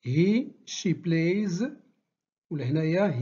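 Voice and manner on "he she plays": "plays" said very slowly with a clear z at the end; z ending demo. Shown as a demonstration. Teacher modelling for class, very slow. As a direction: draw out "He, she plays" and land on the z. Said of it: "نطقوها إما س ولا ز".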